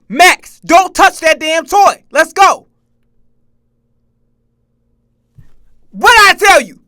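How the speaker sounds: put-on loud, high parent yelling at a kid; mock yelling parent. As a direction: bellow at the kid like a fed-up parent. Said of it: "They be like".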